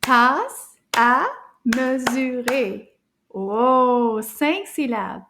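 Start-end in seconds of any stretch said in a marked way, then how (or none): 0.0-2.9 "Tasse à mesurer" slow, every syllable separate. Shown as a demonstration. Teacher clapping little words together, "Tasse à mesurer" in slow beats. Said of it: "let's clap this".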